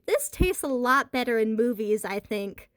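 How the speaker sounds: ordinary delivery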